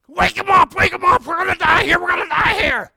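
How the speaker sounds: high, loud frantic yell of terror; panicked shout. Retold or imitated. Disciples in the storm waking Jesus, panicked shouting. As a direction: scream it like you're about to drown.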